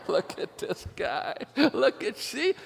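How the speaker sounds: breathy laughing words climbing very high; laughing Holy Spirit. Retold or imitated. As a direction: crow through mock laughter. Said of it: "Holy Spirit's like, you know, he's going".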